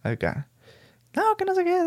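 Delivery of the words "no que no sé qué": lightly voiced tipsy men, much higher; mock drunk guys. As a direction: mimic the drinking guys in a high voice. Imitando a los vatos pisteando. (imitación ligera de borrachos, tono agudo)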